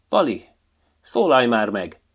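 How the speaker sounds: the fox shouting above the crowd at the magpie; shouting over the crowd. A narrator's reading. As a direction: call out over the noise. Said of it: "igyekezett Karcsi túlkiabálni a többieket".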